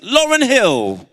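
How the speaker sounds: high, loud, slow name announcement; rousing call-out. Real, not acted. Speaker hailing a heroine to the audience, rousing call. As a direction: shout the name out like a headliner. Calls out names to crowd.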